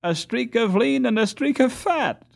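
put-on high, gleeful teasing boy; gleeful joke. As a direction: relish the joke. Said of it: "cried Ludwig".

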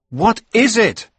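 high, loud urgent question; alarmed cry. Acted. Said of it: "I cried".

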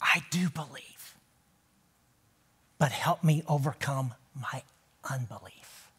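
hushed, breathy whisper of a desperate father; whispered plea. Retold or imitated. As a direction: whisper the plea as if barely able to speak. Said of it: "He cried out".

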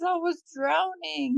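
high, slow plaintive retelling; acted-out childlike wail; imitated crying child. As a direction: voice a little boy's tearful complaint, stretched out high. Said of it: "he was just crying".

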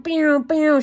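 high, loud playful "pew pew" sounds; zapping sound effect pew pew; mock shooting noises. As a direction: fire off cartoon laser noises. Mimicking shooting energy.

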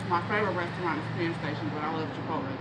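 words between bites of food; talking while chewing. Real, not acted. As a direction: mouth partly full of food. Seen 0: Holds burrito throughout, brings food to mouth repeatedly while talking.

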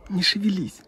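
ordinary talk, nothing marked

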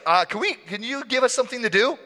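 high, quick, fidgety request; hurried overeager disciple; awkward Peter. Acted. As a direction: beg for a job in comic nervousness. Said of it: "Peter's just like".

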